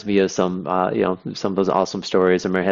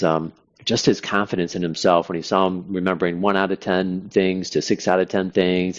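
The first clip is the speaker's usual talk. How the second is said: usual speaking voice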